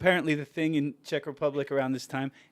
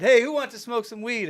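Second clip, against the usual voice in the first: calling out; 9 semitones higher, 4 dB louder than usual. Retold or imitated. Calling out in bar.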